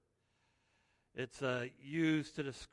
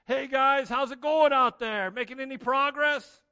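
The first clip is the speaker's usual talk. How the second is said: high, loud breezy calling out; loud carefree hail across the water; casual shore caller; 10 semitones higher, 14 dB louder than usual. Retold or imitated. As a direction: shout it out cheerily from the shore like you don't care. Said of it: "a God that just calls from the shore".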